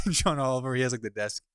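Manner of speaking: ordinary delivery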